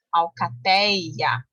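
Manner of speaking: very slow "alcateia", dwelling on the open éi. Shown as a demonstration. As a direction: draw out the "ei" inside "alcateia". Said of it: "o ei".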